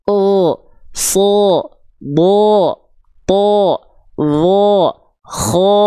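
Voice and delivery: "O, so, bo, to, wo, ho" dibaca tebal; huruf tebal. Shown as a demonstration. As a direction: contoh huruf tebal berbunyi o, lebih keras. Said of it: "keluarnya jadi O". (guru mencontohkan huruf tebal)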